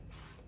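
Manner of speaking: "F" as a soft breathy f sound. Shown as a demonstration. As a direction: blow out "F" for th. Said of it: "T H".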